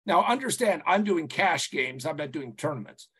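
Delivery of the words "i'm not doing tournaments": normal talking voice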